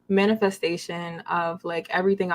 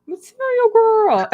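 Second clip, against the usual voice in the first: much higher, louder and slow with a laugh; excited shout; 9 dB louder, slower than usual. Pretend. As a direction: belt out your brand name. Guest shouts her brand name.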